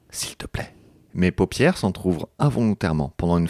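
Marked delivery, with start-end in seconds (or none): 0.1-0.7 voiceless pleading whisper; whispered plea. Acted. Narrator begging to stay in the dream, whispered. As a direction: beg under your breath.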